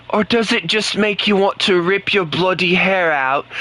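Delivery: mock British accent, bloody added for flavour. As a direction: lay the British on thicker with bloody. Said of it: "speak the British language".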